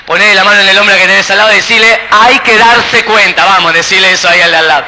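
loud, rapid rallying of the crowd; loud urging. Real, not acted. Preacher urges audience to repeat phrase.

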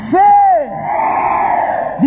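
one long, high preacher's yell; shout. Real, not acted. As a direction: shout it out for the congregation.

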